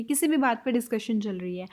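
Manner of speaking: ordinary talk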